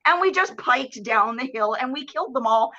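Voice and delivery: ordinary talk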